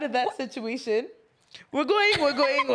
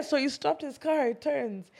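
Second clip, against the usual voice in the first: normal talking voice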